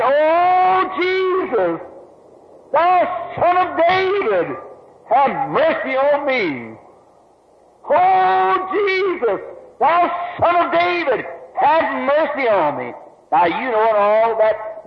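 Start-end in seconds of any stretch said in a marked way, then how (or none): 0.0-6.8 high pleading cry, drawn out slowly. Acted. Blind beggar crying out to Jesus on the road, high pleading cry. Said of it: "raised to his feet and cried". 7.9-12.9 high pleading cry at normal pace; crying out again. Acted. Blind beggar calling again to Jesus over the crowd, high pleading cry. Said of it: "raised to his feet and cried".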